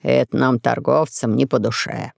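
low, gloomy grumble. A narrator's reading. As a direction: say it darkly and low. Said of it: "мрачно сказал купец".

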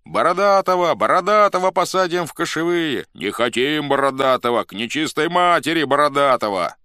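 high, loud rival cries; crowd shouting. A narrator's reading. Factions quarrel over another candidate, shouting. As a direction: shout for and against him. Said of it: "кричала с бранью толпа".

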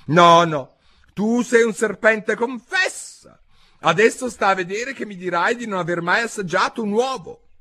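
high, loud accusation; high-pitched scornful bird; accusing pigeon. A narrator's reading. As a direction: press for a confession, high-voiced.

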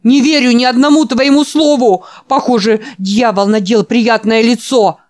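put-on high, loud frantic old man; screaming old man. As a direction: yell in terror at a stranger. (испуганный крик старика)

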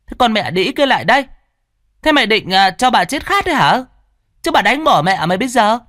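put-on high-pitched shrieking old mistress, loud and fast; shrill screech. A narrator's reading. Mistress screeching at the servant to fetch water. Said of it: "bà rít lên the thé".

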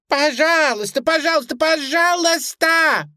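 high, loud piping begging; piercingly high plea, last word stretched; squeaky pleading. A narrator's reading. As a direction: squeal it like a terrified toy bunny. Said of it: "продолжала пищать Сьюзи".

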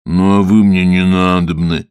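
slow, loud, rude brush-off; gruff voice. Acted. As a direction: rumble it dismissively. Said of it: "грубым голосом ответил Водяной". (грубым голосом)

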